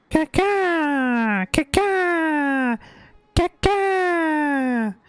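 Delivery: loud high cawing like a crow; crow caws. Pretend. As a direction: shriek it out like a crow. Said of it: "The crows caw".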